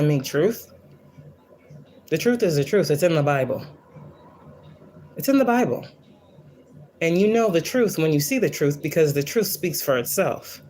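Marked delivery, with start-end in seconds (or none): 5.2-5.9 much higher, insistent repeat; emphatic repeat. Real, not acted. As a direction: push it up high to drive it home. Insisting, says it twice.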